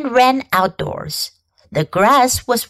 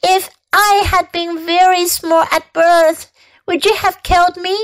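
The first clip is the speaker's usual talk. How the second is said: put-on upset child arguing high; tearful pleading; 8 semitones higher than usual. A narrator's reading. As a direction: plead with her father. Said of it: "cried Fern".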